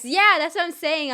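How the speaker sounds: loud, high burst of agreement; emphatic agreement. Real, not acted. Streamer agreeing with chat about being Baymax, loud and emphatic. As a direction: jump in loud and excited.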